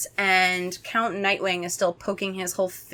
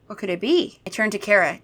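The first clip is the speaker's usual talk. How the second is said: ordinary delivery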